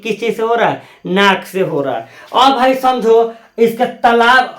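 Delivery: ordinary talk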